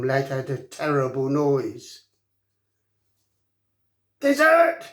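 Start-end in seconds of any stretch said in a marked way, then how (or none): 4.2-4.8 shrill, much higher shriek, louder; a little dragon screeching in excitement. A narrator's reading. Fireworm the dragon shrieks at the boys, piercing shriek. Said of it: "she shrieked".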